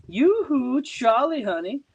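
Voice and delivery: a mermaid luring him in; teasing sing-song call; sweet voice. A narrator's reading. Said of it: "the same sweet voice says".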